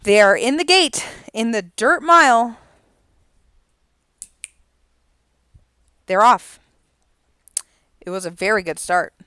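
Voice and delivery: loud race caller delivery. Acted. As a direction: call the field as they break. Calls a horse race.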